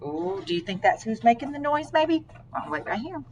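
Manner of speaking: usual speaking voice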